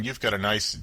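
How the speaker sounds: ordinary talk, nothing marked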